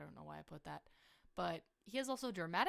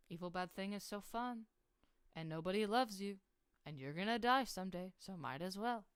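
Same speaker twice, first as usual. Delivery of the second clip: tempting void god; 5 dB louder than usual. Acted. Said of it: "little worm in his ear".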